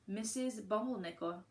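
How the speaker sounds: ordinary delivery, nothing marked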